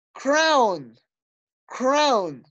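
"crown" twice, each drawn out and falling; stretched, falling "crown", repeated for the class to copy; pronunciation model. Shown as a demonstration. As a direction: model "crown" carefully for learners. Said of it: "Las voy a pronunciar yo".

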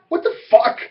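high, sharp disbelieving swear; retold outrage. Retold confrontation with visitor.

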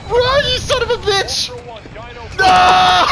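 panicked shout. Real, not acted. Teammate runs wrong way, panicking.

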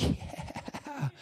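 high, soft airy mock cheer; breathy little squeal of joy; all happy. People coming down the trail, all happy. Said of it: "like, all happy".

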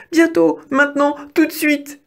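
high-pitched, hurried reassurance; urgent mouse cry. A narrator's reading. Mouse answering the hungry wolf, high and urgent. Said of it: "s'écria Didi".

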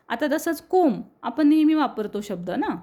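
ordinary delivery

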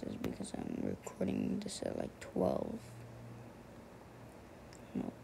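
hushed late-night whisper. Real, not acted. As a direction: whisper so nobody wakes up. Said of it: "why I sound like this".

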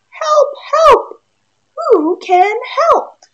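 high, loud cry for help; a stuck duck yelling high and loud; squeaky desperate duck shout; duck calling for help. Acted. Duck cries out in story.

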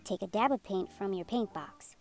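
usual speaking voice

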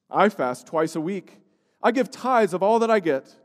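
a hint of a smug, self-praising Pharisee; lightly voiced proud Pharisee. A narrator's reading. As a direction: pray it a little loud and boastful. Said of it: "prayed thus".

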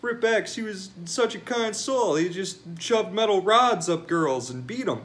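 a hint of a sentimental fan; mocking fan. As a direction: parody a gushing mourner, half-hearted. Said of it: "It's like people that like".

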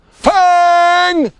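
long, high, full-out bellow. A narrator's reading. Bungo calling his dog, bellowing. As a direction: bellow the name, drawn out. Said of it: "bellowed Bungo".

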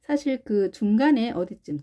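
normal talking voice

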